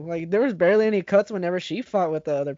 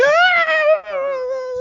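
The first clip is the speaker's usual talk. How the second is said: startled yell; 4 dB louder than usual. Retold or imitated. Imitating the bodyguard's face.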